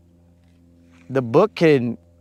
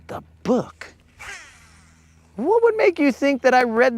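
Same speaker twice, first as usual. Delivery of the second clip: incredulous; 10 semitones higher than usual. Real, not acted. Incredulous at chat suggestion.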